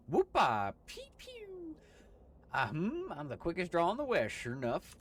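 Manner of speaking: loud Western braggart with gun noises; hammy cowboy. Acted. Gabby boasting about his quick draw, loud cowboy. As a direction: show off like a gunslinger.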